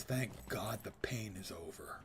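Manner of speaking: whispered, weary relief. Retold or imitated. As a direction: breathe it out, exhausted. Contrast with players' joy.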